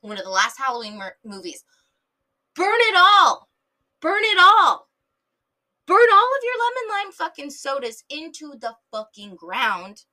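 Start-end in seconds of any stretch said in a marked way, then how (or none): 2.5-10.1 high, loud, fierce rallying cry; loud imitation of a movie heroine screaming orders; dramatic movie yell. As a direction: shout it like a horror heroine making her last stand. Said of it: "As Jamie Lee Curtis, Laurie Strode herself said".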